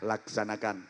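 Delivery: low, soft command; calm inspector reply. Shown as a demonstration. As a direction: answer the report quietly. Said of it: "Inspektur jawabnya".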